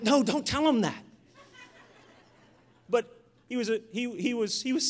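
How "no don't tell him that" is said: higher-pitched mock protest; embarrassed protest. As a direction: plead with dad to stop, half laughing it off. Embarrassed son protesting to father.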